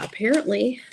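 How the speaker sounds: usual speaking voice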